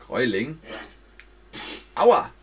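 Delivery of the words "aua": loud high ouch; pained ouch. Acted. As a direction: yelp from a hit. Hit in the fight.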